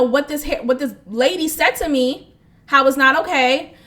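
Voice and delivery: normal talking voice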